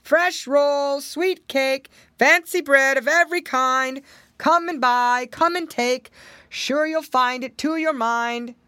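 loud, high hawker calling out wares; street vendor cry. Acted. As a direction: belt it like a market baker. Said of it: "while he cried".

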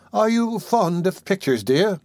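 put-on a motherly old nurse fussing gently; old lady voice. Old nurse coaxing the sick boy to eat, lightly voiced.

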